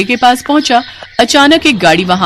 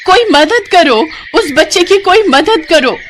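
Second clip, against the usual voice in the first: desperate call for help; 8 semitones higher than usual. Acted. Neelam cries out to passers-by to save the boy. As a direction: cry out to anyone nearby.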